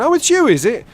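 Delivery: high, loud accusing outburst; irate stranger. Retold or imitated. Stranger answering the repeat call, irate accusation. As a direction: mimic the angry man's identical outburst. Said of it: "says the same thing again".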